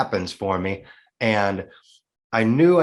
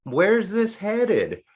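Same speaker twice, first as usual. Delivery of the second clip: curious smile; 9 semitones higher, much slower than usual. Retold or imitated. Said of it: "I'm kinda smiling".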